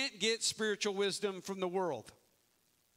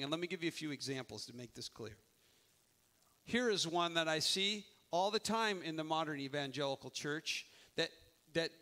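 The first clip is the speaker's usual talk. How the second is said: ordinary talk, nothing marked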